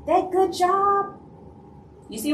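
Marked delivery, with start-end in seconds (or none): 0.1-1.2 high, slow, put-on sweet voice; mock sympathetic praise. Fake friend cooing over the lost job, high and slow. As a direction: coo it slowly, way up high.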